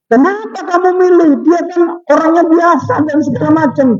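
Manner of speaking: incredulous wife. Retold or imitated. Wife questions choice of groom.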